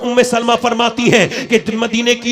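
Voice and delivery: loud, rhythmic, repeated refrain; loud pulpit delivery building in force; forceful preaching. Real, not acted. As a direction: drive each example home loudly.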